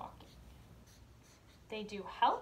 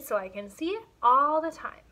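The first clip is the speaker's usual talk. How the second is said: put-on child's voice; 3 semitones higher, 9 dB louder than usual. Emmy's voice in story.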